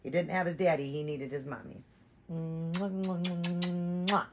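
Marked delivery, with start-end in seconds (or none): 2.3-4.3 kiss sounds. Acted. Kissing the baby doll.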